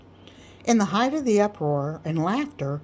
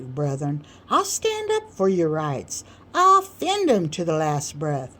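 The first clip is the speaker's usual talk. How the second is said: boastful orator Sam; 3 semitones higher than usual. Acted. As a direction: puff up and preach to the crowd.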